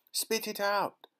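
high-pitched, pressing command; urgent order. A narrator's reading. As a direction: order it out in alarm. Wonka urgently orders her.

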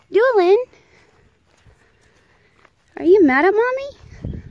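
high, loud coaxing call. Real, not acted. Owner coaxing her sulking horse, high and loud. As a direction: call him over, sweet and high. Calling horse, baby talk.

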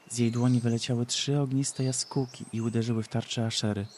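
plain reading voice

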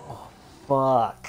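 loud, drawn-out swearing; big exasperated outburst; frustrated curse. Real, not acted. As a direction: shout it as you lose. Just lost in game.

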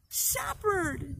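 put-on a frightened girl shrieking for the shepherd; terrified shriek. A narrator's reading. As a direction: scream his name in panic. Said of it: "she shrieked".

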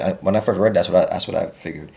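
ordinary talk, nothing marked

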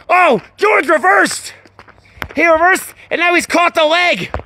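high excited play-by-play shout; excited commentator. Real, not acted. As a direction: call the reversal with a burst of energy. Commentator reacts to reversal.

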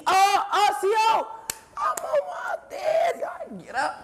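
startled yelps. Retold or imitated. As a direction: jolt awake yelping at the noise. Startled awake by the beating.

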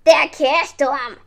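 loud, slow raven croak; krächzender Rabe. A narrator's reading. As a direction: croak it out in alarm. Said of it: "krächzte er plötzlich". (laut und gedehnt gekrächzt)

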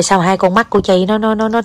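ordinary talk

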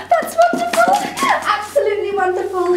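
high, thrilled gasp of delight. Acted. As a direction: gasp with joy at the news. Mother hears son passed.

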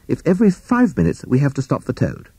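Captain Beaky. A narrator's reading. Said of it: "he panted".

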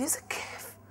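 whispered, to himself; relieved whisper. Retold or imitated. Husband to himself as wife finishes early, whispering. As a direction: breathe it out in relief.

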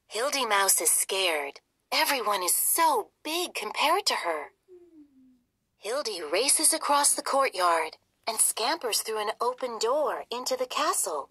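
ordinary delivery, nothing marked

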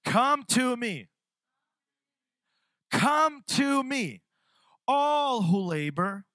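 a slow call to come, stretched for the crowd to repeat; emphatic call and response. Shown as a demonstration. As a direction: draw out the call for all to repeat. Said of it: "Let's all say".

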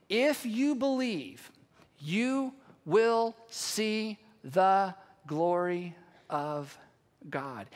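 very slow, word by word; solemn promise. Acted. Preacher repeating Jesus's promise of glory, slow and solemn.

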